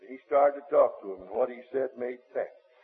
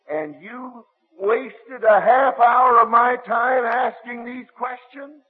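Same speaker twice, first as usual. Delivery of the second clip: slow, louder, much higher outrage; indignant scolding; 10 semitones higher, 9 dB louder, slower than usual. Retold or imitated. Bill scolding the student for wasting his time, slow indignant. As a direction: drag out the accusation.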